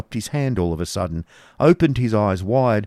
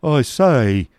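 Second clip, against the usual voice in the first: raised, loud protest; scared expostulation; 5 semitones higher, 5 dB louder than usual. A narrator's reading. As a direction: protest in alarm, higher and louder. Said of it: "expostulated the figure, looking very much scared".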